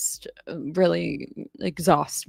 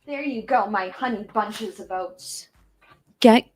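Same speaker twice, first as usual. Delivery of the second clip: tender pet talk; 3 semitones higher than usual. Real, not acted. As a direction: soothe the dog as you tuck her in. Tucking the dog in with blanket.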